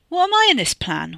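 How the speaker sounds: high, loud indignant demand. A narrator's reading. Wes challenges Katie about the plan, demanding. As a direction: demand to know your part. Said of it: "Wes demanded".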